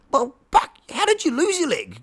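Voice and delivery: high, disbelieving ask; mock astonished question; incredulous question. Retelling own question to cat.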